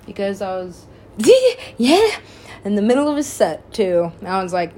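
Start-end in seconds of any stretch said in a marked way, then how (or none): 0.9-1.7 loud high laugh; a real squeal of laughter; high burst of laughter. Real, not acted.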